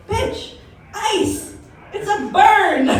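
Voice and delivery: mock-exasperated "bitch, ice"; exasperated retort. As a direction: rise up and snap back in disbelief. Exasperated at coworker's butter idea.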